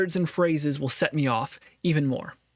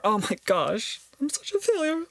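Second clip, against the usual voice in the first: high, whiny self-pity; a hammed-up groan of defeat; mock despair; 9 semitones higher than usual. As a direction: wail it like a failure. Acted self-pity example.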